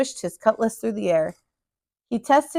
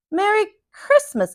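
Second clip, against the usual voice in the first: high, slow little mouse call; 10 semitones higher than usual. A narrator's reading. The mouse calls a greeting to the captain, high and slow. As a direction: sing out a cheery greeting. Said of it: "called the mouse".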